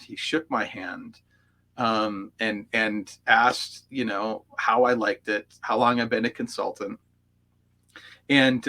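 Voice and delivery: normal talking voice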